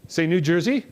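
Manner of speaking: ordinary delivery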